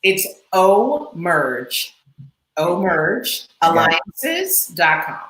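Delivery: very slow, word-by-word company name; speaking slowly. Shown as a demonstration. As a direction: draw out Omerge Alliances so a listener can catch up. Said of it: "You gotta speak slowly with that".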